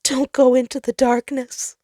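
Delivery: high, loud, trembling plea; a frightened voice pleading; shaking plea. Acted. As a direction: beg them away from the dark. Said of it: "His voice shook".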